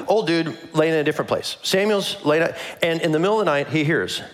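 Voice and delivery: ordinary delivery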